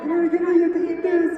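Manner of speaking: high sing-song voice, coaxing a dog; high playful quote voice. Retold or imitated. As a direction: coax it up high like talking to a pet. Quoting friend offering dog treat.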